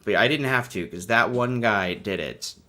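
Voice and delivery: ordinary delivery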